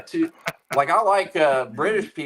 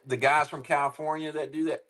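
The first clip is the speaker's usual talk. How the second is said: ordinary talk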